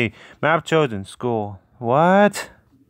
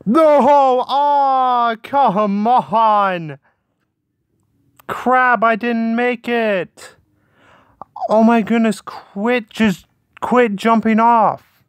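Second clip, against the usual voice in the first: frustrated shout; 6 semitones higher, slower than usual. Real, not acted. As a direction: yell it in frustration. Dismay at losing round.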